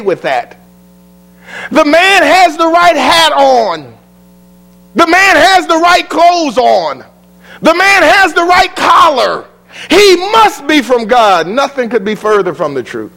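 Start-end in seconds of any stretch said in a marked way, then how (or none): 1.7-11.5 mock-admiring list, higher and punched; sarcastic preaching. Preacher mocking showy clergy, sarcastic emphasis. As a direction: lay on the irony, each line a jab. Sarcastically voicing worldly judgement.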